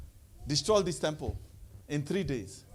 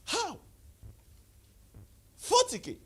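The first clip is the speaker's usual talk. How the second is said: 1 incredulous. Real, not acted. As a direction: hammer home the disbelief. Repeats the sum in disbelief.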